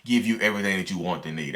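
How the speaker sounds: ordinary talk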